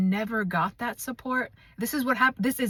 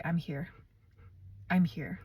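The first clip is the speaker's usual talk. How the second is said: quiet and tender, much softer than her talk; soft reassurance; 4 semitones lower, 7 dB quieter than usual. Shown as a demonstration. Hand on heart each morning, she softly reassures herself. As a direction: murmur it gently to your own heart. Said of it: "put my hand on my heart and say".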